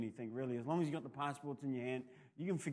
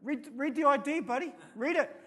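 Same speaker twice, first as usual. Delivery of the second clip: higher, louder mock defiance at a cop; daring the cop to read the card; defiant to the cop; 11 semitones higher, 7 dB louder than usual. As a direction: snap back at the cop, half playing. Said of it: "I feel like saying to the cop".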